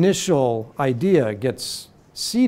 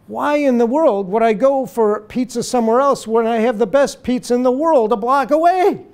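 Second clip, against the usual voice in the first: put-on higher, louder incredulous old-man voice; lightly voiced old dad, baffled anyone would look elsewhere; stubborn old father; 9 semitones higher, 5 dB louder than usual. Ninety-nine-year-old father refusing to try other pizza, incredulous.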